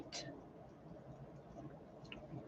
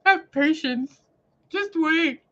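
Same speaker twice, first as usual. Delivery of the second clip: higher, otherworldly calm voice; Jesus answering; 10 semitones higher, slower than usual. Retold or imitated. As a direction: voice Jesus gently. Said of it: "And he's like".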